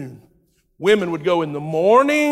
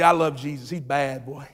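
ordinary talk, nothing marked

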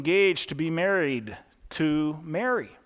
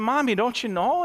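put-on little girl; 6 semitones higher than usual. Girl quoted in joke story.

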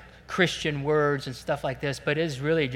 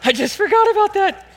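flustered excitement; 9 dB louder than usual. Real, not acted. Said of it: "Got all excited".

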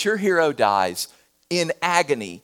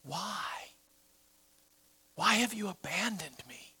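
anguished whisper; 2 semitones higher, 15 dB quieter than usual. A narrator's reading. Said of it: "Jesus dies crying out".

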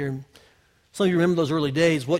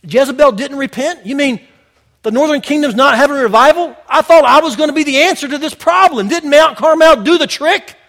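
sarcastic Elijah complaint; 9 semitones higher, 13 dB louder than usual. Retold or imitated. Voicing Elijah's disappointed complaint.

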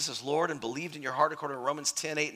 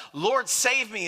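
crying out; 7 semitones higher, 4 dB louder, slower than usual. Acted. Peter sinking, cries out.

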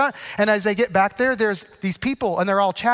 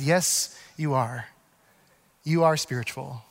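gentle affirmation; 8 semitones lower, 5 dB quieter, slower than usual. Retold or imitated. Affirming her softly.

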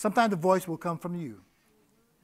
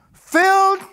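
"filled" shouted high and loud; emphatic filled; 13 semitones higher, 9 dB louder than usual. Retold or imitated. Promising he'll leave full of the Spirit, "filled" belted out. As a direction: punch "filled" with everything. Climax of the father-in-law's promise.

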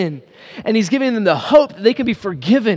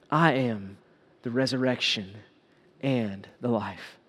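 gentle solemn pronouncement; 10 semitones lower, 11 dB quieter than usual. Acted. Jesus gives Martha a comforting truth, softly and solemnly.

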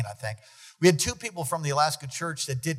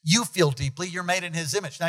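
usual speaking voice

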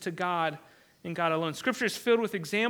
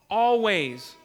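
Always, louder and final; emphatic loud always; 5 dB louder than usual. Acted. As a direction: punch the word Always louder.

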